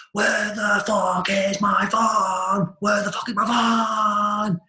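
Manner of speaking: drawn-out angry rant building to a full shout; enraged neighbour. Retold or imitated. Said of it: "I could hear the guy going".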